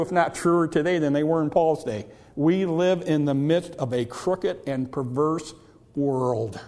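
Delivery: ordinary delivery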